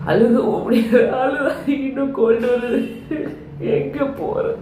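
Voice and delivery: whiny. Acted. Complaining about being sick.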